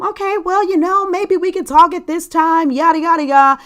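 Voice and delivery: imitating a casual goodbye; mock breezy brush-off. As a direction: brush her off lightly and casually. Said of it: "And I said".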